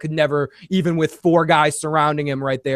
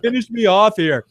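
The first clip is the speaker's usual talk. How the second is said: mock voice; 6 semitones higher than usual. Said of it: "jokey put-on line".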